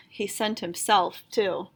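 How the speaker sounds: ordinary delivery, nothing marked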